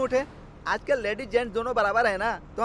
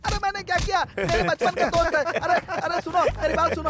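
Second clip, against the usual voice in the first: high, urgent please-don't-hit pleas; panicked pleading; 7 semitones higher than usual. Acted. Begging the girls to stop hitting his friend, panicked. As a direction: beg them to stop the beating.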